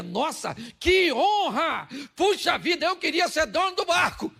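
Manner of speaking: high, loud gushing exclamation; acted admiration. Imagined admirer of Jesus exclaims.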